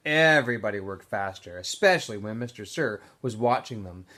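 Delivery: ordinary delivery, nothing marked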